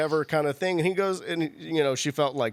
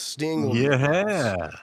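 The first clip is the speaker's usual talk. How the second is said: booming hype-man announcement, louder; announcer voice; 4 dB louder than usual. Shown as a demonstration. Host hyping the Sting booking, announcer boom. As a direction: announce it like a big arena reveal.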